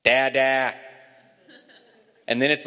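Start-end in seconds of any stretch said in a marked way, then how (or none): 0.0-0.8 put-on toddler's loud repeated call; screaming toddler. Father mimics son waking the house, insistent toddler call. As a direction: imitate a child calling out from bed. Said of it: "he starts screaming".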